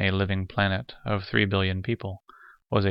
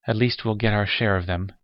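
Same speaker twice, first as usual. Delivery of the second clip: ordinary delivery, nothing marked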